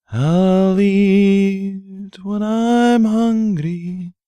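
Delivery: usual speaking voice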